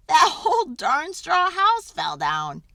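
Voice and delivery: excited. A narrator's reading. House collapses in story.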